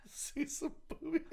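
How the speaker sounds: high whispered echo through laughter; giggling whispered repeat; laughing whisper. Real, not acted. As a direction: wheeze the words out laughing. Mocking partner through laughter.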